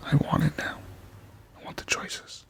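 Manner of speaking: hushed, whispered craving; playful whisper. Pretend. Player telling viewers he wants the game, playful whisper. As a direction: whisper it like a secret longing.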